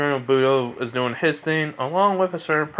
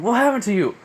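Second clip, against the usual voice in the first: put-on indignant girl; 10 semitones higher, 4 dB louder than usual. She scolds the late hero.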